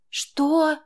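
high, loud disbelieving question; stunned exclamation. A narrator's reading. Girl hearing Max's demand, stunned exclamation. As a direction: blurt it out in shock. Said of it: "ошарашенно воскликнула я".

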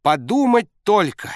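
high loud delighted yell; shouting coach. A narrator's reading. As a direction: roar it in disbelief. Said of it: "орал тренер".

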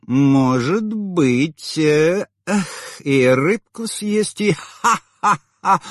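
high slow tipsy cackle; drunken laughter. A narrator's reading. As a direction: drawl then burst out laughing. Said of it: "расхохоталась она".

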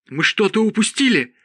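high-pitched cry of alarm; alarmed exclamation. A narrator's reading. Zod realises they missed something, alarmed exclamation. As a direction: exclaim it, voice jumping up. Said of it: "воскликнул Зод".

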